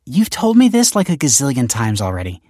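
high, exasperated daughter's complaint; scowling. A narrator's reading. Daughter fed up with dad's repeated advice, scowling. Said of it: "she scowled".

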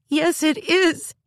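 high, loud, slow tearful retort; tearful insistence. Acted. Girl insists to her sister, close to tears. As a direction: snap back on the verge of sobbing. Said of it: "started to sob".

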